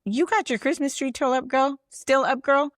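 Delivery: put-on high, bright girlfriend tease; playful DM voice. Messaging a stranger about her Christmas tree, high and playful. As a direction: pitch it way up like a friendly text. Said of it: "I'm gonna message you and be like".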